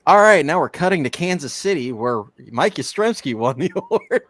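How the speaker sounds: mock announcer. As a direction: hype it like a TV announcer. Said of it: "they're gonna go".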